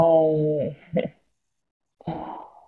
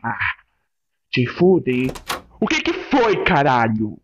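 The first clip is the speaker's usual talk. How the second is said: ordinary talk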